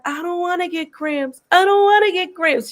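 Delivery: put-on high, half-comic crying child voice; imitating a crying child. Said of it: "she started crying like".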